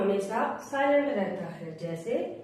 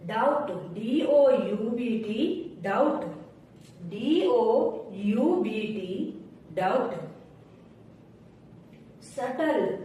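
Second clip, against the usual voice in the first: normal talking voice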